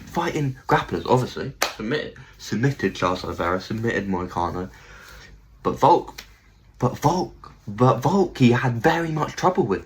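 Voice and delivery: ordinary talk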